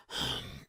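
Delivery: soft, pointed exhale; demonstrated impatient British sigh; exasperated sigh. Shown as a demonstration. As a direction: huff in wordless disapproval. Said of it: "the whole".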